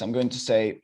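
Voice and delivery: ordinary talk, nothing marked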